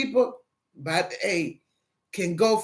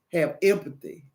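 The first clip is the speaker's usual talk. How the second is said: ordinary talk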